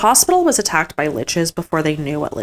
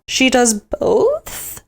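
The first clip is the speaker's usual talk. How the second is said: incredulous question; 7 semitones higher, much slower than usual. Real, not acted. Dismay at Helena's choice.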